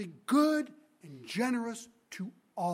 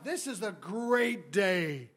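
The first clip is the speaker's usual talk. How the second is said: very slow, savouring delivery; relishing; much slower than usual. Acted. First-hired workers thinking they will get more, relishing. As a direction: stretch each word out with satisfaction.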